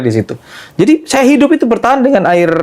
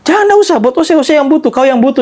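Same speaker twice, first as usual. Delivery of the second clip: high, insistent Ambonese refusal; imitated Ambonese voice, pitched very high; Ambonese accent; 11 semitones higher than usual. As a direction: mimic the old man waving off money in Ambonese dialect. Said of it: "Pakai logat Ambon kan".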